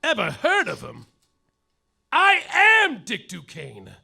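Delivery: ordinary talk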